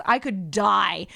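ordinary talk, nothing marked

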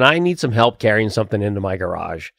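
understated old-lady impression; lightly voiced old lady. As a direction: lightly imitate an elderly neighbor asking a favor. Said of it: "there would be old ladies saying".

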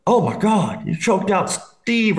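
high, drawn-out mock alarm; high-voiced, hammed-up disbelief; shocked onlooker. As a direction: imitate a shocked friend calling out. Voices alarmed friend in the corner.

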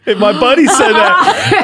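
words pushed up high on a laugh. Real, not acted. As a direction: talk through real laughter. Cracks up telling the story.

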